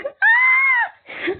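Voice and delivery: very high, piercing mock scream; a shrill copy of the singer's cry; imitated scream. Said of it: "That scream is like".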